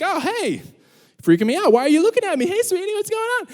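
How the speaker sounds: squeaky uneasy sweet-talk to a dog; mock nervous, very high cooing; high-pitched pet talk. Acts out talking to staring dog.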